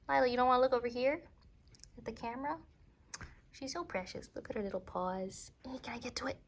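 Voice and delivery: high soft cooing at a pet; overwhelmed by cuteness. Real, not acted. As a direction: coo sweetly to a cat. Cooing at her cat.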